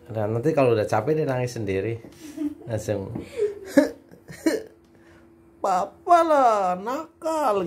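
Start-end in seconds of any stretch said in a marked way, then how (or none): 5.6-7.6 imitating whiny child.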